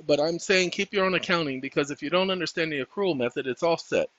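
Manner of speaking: ordinary delivery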